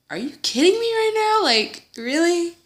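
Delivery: hammed-up outrage at the guests; acting out disbelief at friends fleeing; mock disbelief. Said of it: "And I'm like".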